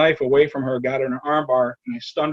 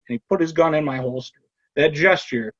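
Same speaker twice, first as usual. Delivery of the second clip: usual speaking voice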